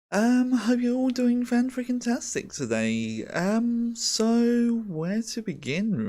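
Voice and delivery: high bubbly kawaii greeting; excited anime fan. Real, not acted. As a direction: squeal it like a cute idol. Bubbly kawaii princess stream greeting.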